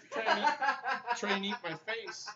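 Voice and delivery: high, loud, slow laughing words. Real, not acted. Playing with baby, laughing.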